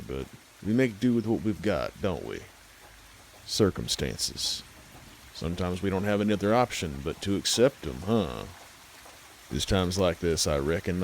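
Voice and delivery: plain reading voice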